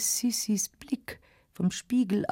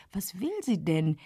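ordinary delivery

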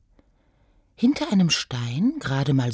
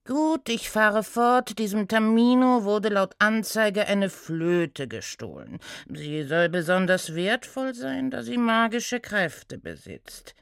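reluctant centaur; 4 semitones higher than usual. Acted. Inspector briefing his intern, reluctant drone. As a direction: deliver the case with weary patience.

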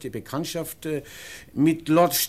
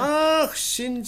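überrascht; 13 semitones higher, 5 dB louder than usual. Acted. Surprised at the other's suggestion.

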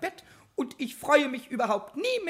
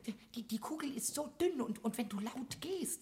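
much softer, anxious hush; hushed goblin; 3 semitones lower, 14 dB quieter than usual. Acted. Goblin hushing the carpenter so the fragile bauble survives. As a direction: tiptoe with your voice. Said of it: "geh nicht so laut".

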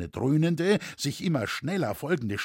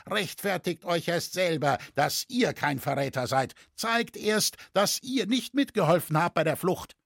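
mild shout, raised pitch; accusing, higher voice; shouted accusation; 6 semitones higher than usual. A narrator's reading. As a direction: challenge him to prove his innocence. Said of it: "schrie ein Dritter".

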